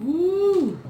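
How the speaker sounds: high excited whoop. Real, not acted. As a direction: whoop with delight. Opening the crackling bag.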